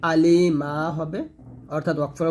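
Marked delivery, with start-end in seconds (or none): 0.1-0.7 very slow আলিমা with a long final আ; slow recitation demo; tajweed stop form. Shown as a demonstration. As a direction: stretch আলিমা out, ending on a held a. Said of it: "যদি আমরা ওয়াকফ ধরি".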